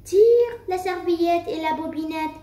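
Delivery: joyful cry. A narrator's reading. Grandmother welcoming her granddaughter, mildly joyful call. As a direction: call out gladly, pitched a little up. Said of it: "s'écrie la grand-mère toute joyeuse".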